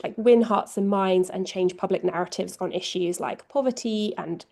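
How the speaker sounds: ordinary delivery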